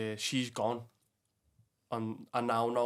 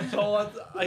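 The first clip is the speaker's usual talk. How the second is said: laughing; 12 semitones higher, 8 dB louder than usual. Real, not acted. Still laughing at own story.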